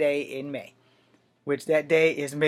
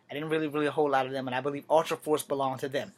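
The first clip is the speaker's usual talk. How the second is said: ordinary delivery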